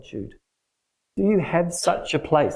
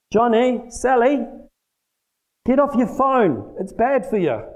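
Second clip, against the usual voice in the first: put-on high, pleading parent nagging the kids; scolding parent; 9 semitones higher, slower than usual. Parent telling the kids to get off their phones, high and nagging. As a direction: play the exasperated parent, pitched up high. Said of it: "This is how parents bring up their kids".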